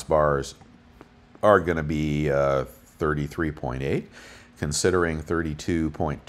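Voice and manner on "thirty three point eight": ordinary delivery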